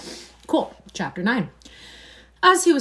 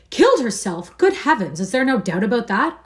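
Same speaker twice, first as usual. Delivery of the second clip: shocked, raised and louder; horrified cry; 5 semitones higher, 8 dB louder than usual. A narrator's reading. Basil crying out in horror at the news, louder. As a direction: gasp it out in horror. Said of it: "cried Hallward... expression of horror".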